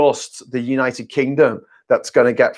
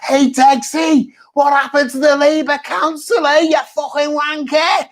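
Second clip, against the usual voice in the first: Scouse heckler; 12 semitones higher than usual. Retold or imitated. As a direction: yell across the gig in a thick Scouse accent. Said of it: "proper Scouser comes up".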